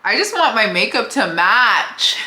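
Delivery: high, loud comic lament; exaggerated plea, played for laughs; mock-sad outburst. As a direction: wail it in mock despair, half joking. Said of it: "I was a little sad".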